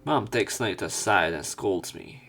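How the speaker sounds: normal talking voice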